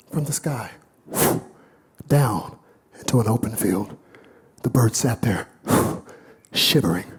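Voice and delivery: low, unhurried parable telling; story narration. A narrator's reading. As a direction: draw them into the tale. Tells the parable slowly.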